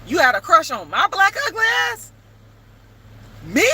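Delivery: retold astonished question; incredulous. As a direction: ask it like it's impossible. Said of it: "And I said to him".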